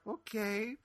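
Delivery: small, high, soft mock-timid reply; tiny, cowed acknowledgement; meekly shutting up. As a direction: back down meekly, rising at the end. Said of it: "just kinda shut up".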